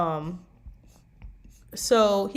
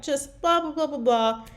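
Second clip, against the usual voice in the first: higher-pitched mock babble; yapping chatter; 7 semitones higher than usual. Date chattering at him, mock yapping. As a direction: imitate someone prattling on in empty syllables.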